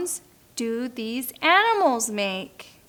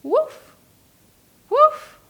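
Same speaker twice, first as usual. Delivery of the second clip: high, loud woof; yappy puppy woof; dog bark; 3 dB louder than usual. Shown as a demonstration. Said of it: "A dog".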